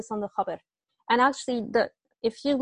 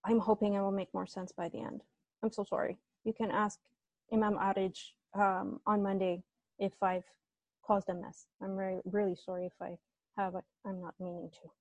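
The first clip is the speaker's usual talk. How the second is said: quiet, lowered apology trailing away; apologetic; 3 semitones lower, 8 dB quieter than usual. Real, not acted. Tutor apologising to students for a muddled answer, apologetic. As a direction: say sorry softly, sinking toward the end.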